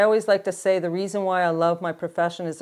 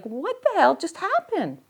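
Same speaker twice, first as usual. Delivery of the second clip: stunned disbelief; 10 semitones higher than usual. Retold or imitated. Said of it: "with this big box going".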